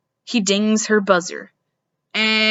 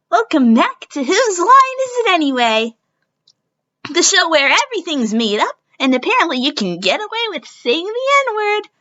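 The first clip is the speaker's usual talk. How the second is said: game show host; 7 semitones higher, 3 dB louder than usual. Acted. Host welcome announcement.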